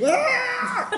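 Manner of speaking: high, drawn-out falling scream; acted scream. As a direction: scream like someone falling into a ravine. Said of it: "il crie".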